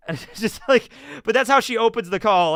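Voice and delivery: ordinary delivery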